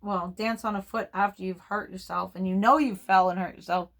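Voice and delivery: ordinary talk, nothing marked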